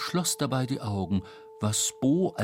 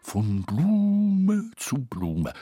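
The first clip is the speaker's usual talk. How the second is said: dreamy; 4 semitones lower, much slower than usual. Acted. Dreamy Tete floating in fantasy.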